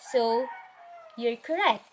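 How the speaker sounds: normal talking voice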